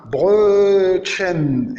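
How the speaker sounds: "Brötchen" with a long, rounded eu; lengthened O umlaut. Shown as a demonstration. Teacher giving the O umlaut example, lengthened vowel. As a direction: stretch the ö of "Brötchen". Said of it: "O umlaut accentué allongé".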